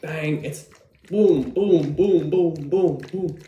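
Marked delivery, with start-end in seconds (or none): 1.1-3.4 punchy shouted booms; loud hype. Acted. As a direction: bang out each boom loudly. Shaking the bottle, hyping it up.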